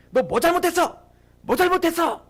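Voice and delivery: high, barked mock scolding; acted interrogation. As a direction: imitate a boss demanding a confession. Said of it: "막 이렇게 물어보는데".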